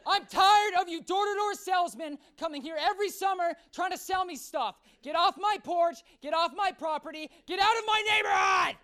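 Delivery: angry man yelling at a salesman. Retold or imitated. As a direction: scream him off the porch. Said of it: "a guy is staring at me and goes".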